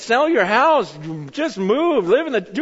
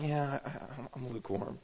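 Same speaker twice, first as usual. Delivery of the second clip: offhand church admission; 9 semitones lower, 20 dB quieter than usual. Retold or imitated. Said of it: "we do this in church".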